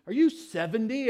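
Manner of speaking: put-on high, loud kid's guess, innocently blunt; curious kid. Kid guessing his age.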